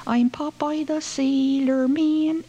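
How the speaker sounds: high, slow shouted Popeye; loud Yankee child yell. A narrator's reading. The child shouting from upstairs, high slow yell. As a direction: bellow it like a brash kid. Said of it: "the loudest Yankee voice ... yells out".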